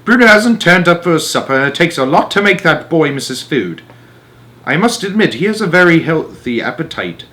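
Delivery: lightly voiced old grandmother; a hint of an elderly Norwegian lady. Grandmother speaks in story.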